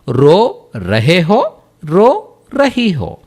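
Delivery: very slow, separated Hindi syllables; slow teaching demonstration. Shown as a demonstration. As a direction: draw out each word for learners. Teacher contrasts masculine and feminine forms.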